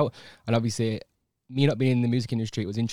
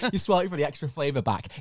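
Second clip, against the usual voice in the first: ordinary talk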